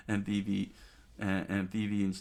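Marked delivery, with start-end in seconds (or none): none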